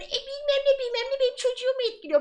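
high, nasal-free mock parent whine; mocking whiny parent. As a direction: mimic a complaining parent, high pitched.

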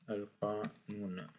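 "Al-qanoun" drawn out slowly and clearly; slow demo pronunciation. Shown as a demonstration. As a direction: model "Al-qanoun" slowly for the class. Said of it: "on l'appelle al-qanoun".

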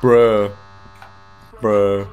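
a loud, flat groan of disbelief at the music; loud bruh. Real, not acted. Gamer reacts to the game music with a loud bruh.

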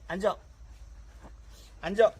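loud sharp sit command; firm dog commands. Real, not acted. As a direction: shout the order at the dog.

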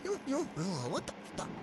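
usual speaking voice

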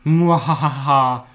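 slow mock villain laugh; evil cackle. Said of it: "I'm cackling evilly".

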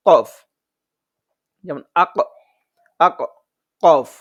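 কফ and আক said thick from the back of the tongue; heavy qaf, loud. Shown as a demonstration. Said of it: "এটা হচ্ছে মোটা করে পড়তে হবে". (মোটা করে কফ)

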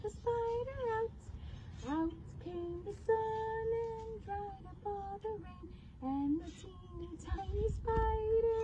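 tiny high squeaky voice; teeny tiny voice. Shown as a demonstration. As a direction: make it small and high. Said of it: "we have to have teeny tiny voices".

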